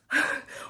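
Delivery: airy breathless laugh. Real, not acted. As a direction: laugh while winded. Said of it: "Why am I out of breath?".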